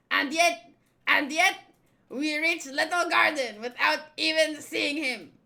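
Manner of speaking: hammed-up anime henchman boss; flamboyant villain role. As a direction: play a showy, bossy character, punching the shouts. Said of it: "the best I can do for, um, this voice".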